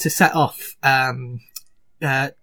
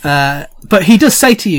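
normal talking voice

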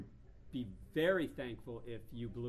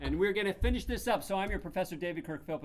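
ordinary talk

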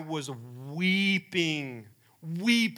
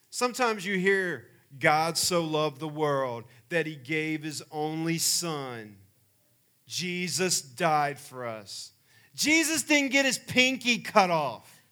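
much higher and louder, building preacher's cry; fervent preaching climax, slow and loud; 5 semitones higher, 8 dB louder, slower than usual. Acted. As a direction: drive the point home at full force.

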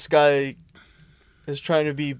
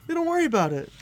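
much higher, sugary don't-worry voice; mock sweet dealer; 13 semitones higher, faster than usual.

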